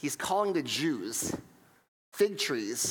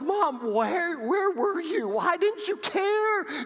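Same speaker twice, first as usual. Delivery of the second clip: very high, drawn-out mock wail; retold screaming; 14 semitones higher, 4 dB louder, much slower than usual. A rescued boy to his mom, hammed-up screaming wail. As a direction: wail it high and slow like an outraged child. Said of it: "you know what I was screaming".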